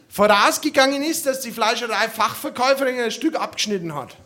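normal talking voice

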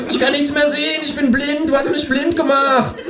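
very high kangaroo wailing; panicked high-pitched accusing yell; screaming in panic. A narrator's reading. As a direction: cry out high as if suddenly blinded. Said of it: "schreit das Känguru".

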